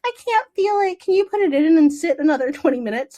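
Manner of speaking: high pitched mock complaint; mock whiny patient. Patient she didn't want to be, mock whiny. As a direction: play the fussy patient.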